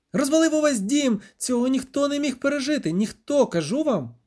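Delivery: high-pitched, distressed outburst; agitated cry. A narrator's reading. Bard lamenting that nobody could have survived, crying out. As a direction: wail it high, insisting to all. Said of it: "скрикнув Любисток".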